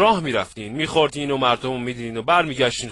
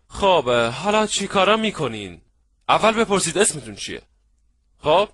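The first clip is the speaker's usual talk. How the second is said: ordinary talk, nothing marked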